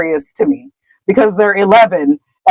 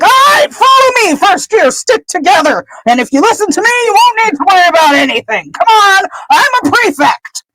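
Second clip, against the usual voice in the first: high, self-important prefect calling out orders; hammed-up officious squawk, herding the first years; Percy; 11 semitones higher than usual. Prefect in his element marshals first years, high bossy calling. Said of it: "Percy's exactly in his element".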